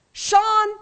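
high, loud mother's call; calling mom. Retold or imitated. Mom calling on the older brother, urgently. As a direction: summon a son in a mom voice.

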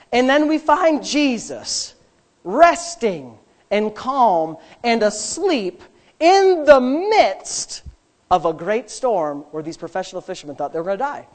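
ordinary talk

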